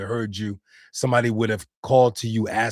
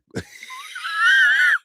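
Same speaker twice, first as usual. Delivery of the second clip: high squealing wheeze of laughter; helpless high laugh; wheezing laugh; 4 dB louder than usual. Real, not acted. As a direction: crack up into a high-pitched wheeze. Cracking up at a chat message.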